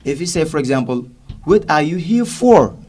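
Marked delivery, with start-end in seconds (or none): 1.4-2.8 "for" in full strong form, loud and high; slow question landing hard on "for"; stressed FOR. Shown as a demonstration. Teacher shows the strong form in a question, stressed FOR. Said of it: "stressed and pronounced".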